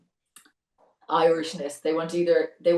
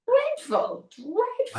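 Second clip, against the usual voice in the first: very high-pitched dismay; appalled exclamation; much slower than usual. Real, not acted. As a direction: squeal it at how awful it is. Exclaiming over awful fake Irish accents.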